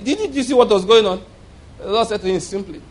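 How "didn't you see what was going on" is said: incredulous question. Retold or imitated. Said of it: "so he looked at the Lord like".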